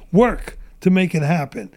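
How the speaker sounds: ordinary talk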